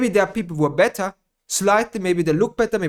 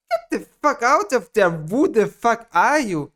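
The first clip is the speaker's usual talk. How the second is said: angry rant; 5 semitones higher, 5 dB louder than usual. Acted. Rant at imagined critic.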